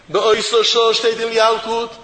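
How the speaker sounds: usual speaking voice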